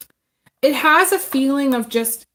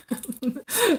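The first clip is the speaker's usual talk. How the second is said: short, soft amused laugh; real soft chuckle mid-thought; stifled laugh; 5 semitones higher, 5 dB quieter than usual. Real, not acted. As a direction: let a little laugh slip out. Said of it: "sorry, I'm laughing".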